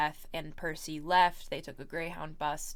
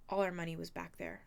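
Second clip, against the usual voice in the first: usual speaking voice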